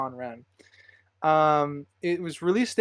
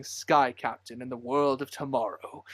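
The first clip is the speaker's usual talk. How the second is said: lightly voiced, lower trailer narrator; movie trailer guy; 3 semitones lower than usual. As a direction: do a hint of a movie trailer voice-over. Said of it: "trailer movie guy voice going".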